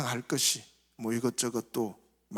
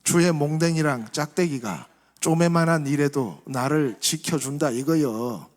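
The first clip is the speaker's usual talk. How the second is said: Jeolla dialect reading. Acted. Pastor reading Psalm 23 to the church in Jeolla dialect. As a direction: read the psalm like a Jeolla grandpa. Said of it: "전라도".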